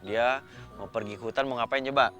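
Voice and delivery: plain reading voice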